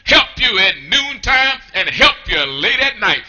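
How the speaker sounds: ordinary talk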